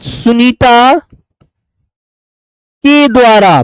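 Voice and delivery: drawn out word by word, very slow; very slow dictation. Shown as a demonstration. Teacher dictating the correct sentence to students, very slow. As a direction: spell it out slowly so students can copy. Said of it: "समझ लो छोटी सी बात".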